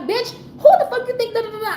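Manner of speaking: high-pitched retold outburst; going off. Customer cursing out a rude worker, high-pitched going-off. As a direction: fire it off high, trailing into nonsense syllables. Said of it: "I went all".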